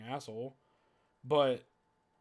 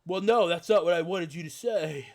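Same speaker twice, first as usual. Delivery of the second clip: mock indignant; 4 semitones higher, 8 dB louder than usual. Imitating offended narcissist.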